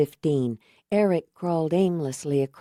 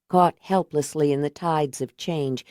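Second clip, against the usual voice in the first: ordinary delivery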